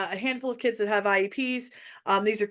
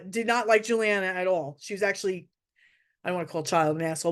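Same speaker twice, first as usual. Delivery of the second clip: ordinary delivery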